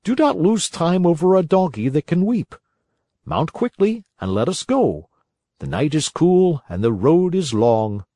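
lightly voiced little man; a hint of a sly coachman. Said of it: "said the little man".

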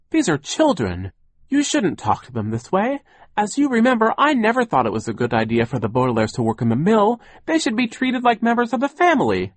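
high, earnest pleading; gentle man's anxious objection; kindly protest. A narrator's reading. Charles pleads with Sir.

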